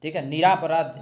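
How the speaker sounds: usual speaking voice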